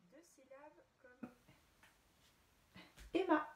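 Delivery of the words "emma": excited reveal. Acted. Teacher reveals photo to class.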